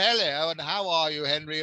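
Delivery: lightly voiced posh English accent. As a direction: try to sound proper and posh, lightly. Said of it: "I tried for ages to say".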